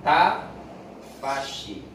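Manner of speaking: Tafashi stretched, sh hissed long; drawn-out tafashi. Shown as a demonstration. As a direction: let the air spread on tafashi. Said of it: "shin itu punya sifat tafashi". (tafashi dipanjangkan)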